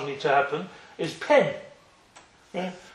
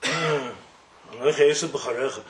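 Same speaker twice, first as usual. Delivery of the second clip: "Anoichi Esau b'charecha" said slower and lower, as if reluctant; hesitant lie; 6 semitones lower than usual. Shown as a demonstration. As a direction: drag out "Anoichi Esau b'charecha" like a son forcing himself to lie.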